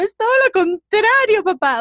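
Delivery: lloriqueo exagerado, agudo y fuerte; drama queen llorosa. Retold or imitated. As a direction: protesta a papá como una drama queen llorando. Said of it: "[name] Drama Queen, me puse a llorar". (berrinche llorón y teatral)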